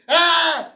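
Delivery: sharp high scream. Acted. The healed woman screams out, piercing and high. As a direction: let out a piercing cry. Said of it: "something screamed".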